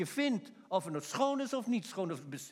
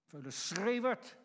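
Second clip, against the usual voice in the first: scolding rebuke; 5 semitones higher, slower than usual. Acted. Passers-by rebuking the shouting man, angry scolding. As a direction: shout him down, dragging out the insult. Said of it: "bestraften hem".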